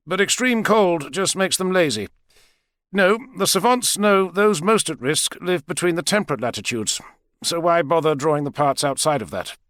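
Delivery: a hint of the lecturing doctor explaining.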